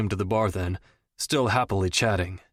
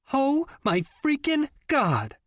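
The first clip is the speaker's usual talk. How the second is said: much higher, slow, squealing shout of delight; excited shout; slower than usual. A narrator's reading. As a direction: scream it out, thrilled to see your friend. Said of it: "Jenny shouted".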